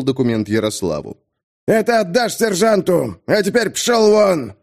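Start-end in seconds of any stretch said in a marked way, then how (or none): 1.7-4.5 furious shout. Acted. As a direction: blast him out the door. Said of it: "Звуковой волной Ярика едва ли не вынесло". (громкий крик капитана)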